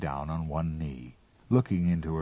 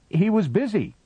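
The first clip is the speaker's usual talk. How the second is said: much higher, a shade louder; shrill little reply pitched way up; high put-on voice; 6 dB louder than usual. A narrator's reading. Captain answers the Martian in a high, raised voice.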